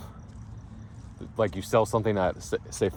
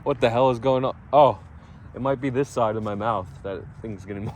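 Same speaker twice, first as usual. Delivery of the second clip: ordinary talk, nothing marked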